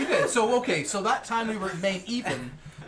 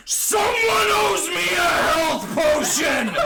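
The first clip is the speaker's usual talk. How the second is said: full-out high, drawn-out battle scream; deafening slow roar for help; top-of-lungs scream; 14 semitones higher, 9 dB louder, much slower than usual. Acted. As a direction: scream it at the top of your lungs, stretching every word. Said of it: "scream out the top of my lungs".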